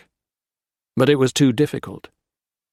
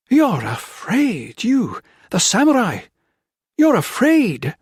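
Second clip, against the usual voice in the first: slow, higher and louder toward the end; rising accusation; 11 semitones higher, 3 dB louder, slower than usual. A narrator's reading. Wife taunting the samurai colonel, building to a high cry.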